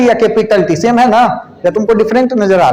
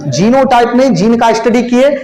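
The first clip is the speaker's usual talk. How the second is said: ordinary talk, nothing marked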